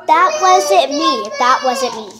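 startled denial. Real, not acted. Denies moving it, startled.

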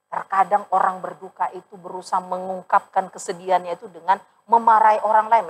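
ordinary delivery

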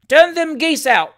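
high, loud, slow shout; outraged heckler's shout; indignant cry. A narrator's reading. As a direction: yell it out with great indignation. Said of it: "cried the ornamental painter's journeyman's party with great indignation".

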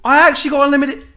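high, fast disbelief; can't believe his luck; amazed. Real, not acted. Surprise at reward.

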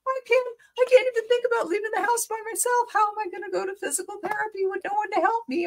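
high, fast, teary pleading, replayed from memory; retold tearful panic. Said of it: "and I burst into tears".

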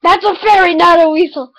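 half-laughing indignant correction. Real, not acted. Vlogger correcting viewers about her drawing, loud laughing protest. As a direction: defend it loudly through a laugh.

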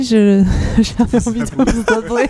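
laughing through the words. Real, not acted. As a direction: giggle while speaking. Laughing through stage fright.

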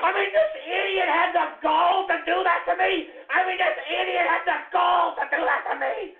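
high, indignant yelling; outraged shout. Real, not acted. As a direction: shout in disbelieving fury. Enraged at the idiot.

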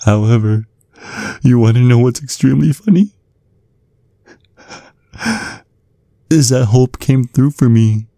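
high, giddy mocking laugh into taunt. Villain gloating over his former mentor.

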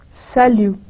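"Salut" said as "sal-yu", no T; silent final T. Shown as a demonstration. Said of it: "it's silent".

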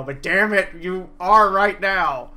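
loud, slow rallying yell; cheering shout. Retold or imitated. Crowd cheers Gray for his dome, loud slow shout. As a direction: hype him up at full volume. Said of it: "So people were like".

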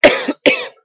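loud hacking cough; chesty cough. Real, not acted. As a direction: cough hard, voice going. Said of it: "Sorry, I'm losing my voice a bit".

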